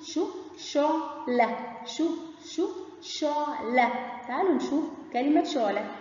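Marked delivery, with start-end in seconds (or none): 0.1-1.6 شو stretched long, then شعلة slow and higher; slow drawn syllables. Shown as a demonstration. Teacher models شو and شعلة slowly for kids. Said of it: "شو زي كلمة شو". 2.6-3.9 شو stretched long, then شعلة slow and higher; slow drawn syllables. Shown as a demonstration. Teacher models شو and شعلة slowly for kids. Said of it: "شو زي كلمة شو".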